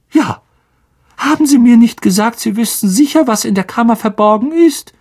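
high piping squeak; squeaky Flitwick. A narrator's reading. Flitwick squeaking his question at Lockhart, high piping. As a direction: pipe it up very high. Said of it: "piepste Professor Flitwick".

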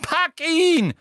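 very high, loud shrieked order; piercing frantic cry; screaming Umbridge. A narrator's reading. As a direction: scream the command at your helper. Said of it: "schrie Umbridge".

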